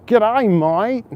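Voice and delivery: high, far-carrying mock Australian accent; Australian greeting. Streamer imitating English speakers greeting each other, mock Australian accent. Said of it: "English speakers are like".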